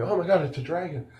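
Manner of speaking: high-pitched delighted realization; really excited. Retold or imitated. Dragon lover recalling the reveal, really excited. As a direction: act out a dragon lover's thrill. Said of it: "I was, like, really excited".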